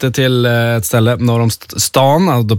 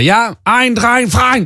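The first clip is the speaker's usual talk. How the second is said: high mock-German count-in; German accent; 10 semitones higher, much slower than usual. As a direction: count them in like a keen German coach. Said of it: "hon pratade lite så här med tysk accent".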